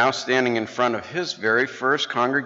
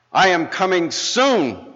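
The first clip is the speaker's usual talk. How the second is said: higher, louder, slow mock cry; hammed-up preacher's cry; retold almost-shout; 6 semitones higher, 4 dB louder, slower than usual. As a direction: call it out slowly, arm raised to the crowd. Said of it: "almost shouting voice".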